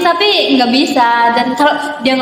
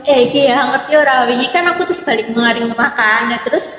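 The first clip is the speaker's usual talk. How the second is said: speaking broad Ngapak Javanese dialect on request. Shown as a demonstration. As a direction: tell the story in thick Ngapak Javanese. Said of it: "coba ngomong ngapak sama mereka".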